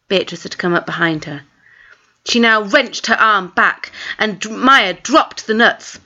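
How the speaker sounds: ordinary delivery, nothing marked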